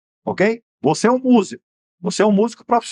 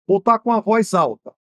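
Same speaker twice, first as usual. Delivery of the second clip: ordinary delivery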